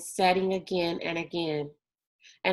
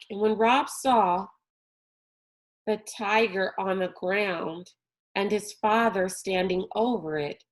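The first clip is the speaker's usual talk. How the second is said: ordinary delivery, nothing marked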